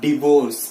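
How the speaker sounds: 'divorce' with a short i, di-vors; short first syllable di in 'divorce'; right pronunciation. Shown as a demonstration. Teacher has students repeat, right pronunciation di-vors. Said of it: "tell it with me".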